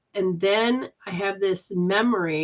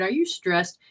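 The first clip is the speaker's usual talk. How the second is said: normal talking voice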